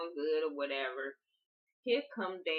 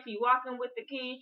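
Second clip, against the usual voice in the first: normal talking voice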